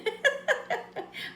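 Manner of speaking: high airy giggle; breathless high-pitched giggle; laugh. Real, not acted. Giggling at song lyric.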